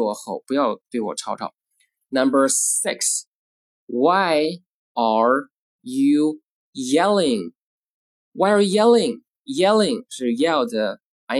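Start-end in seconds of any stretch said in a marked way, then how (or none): 3.9-7.5 very slow, each word stretched and separate; slow model reading. Shown as a demonstration. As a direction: read it word by word for learners. Teacher models question word by word.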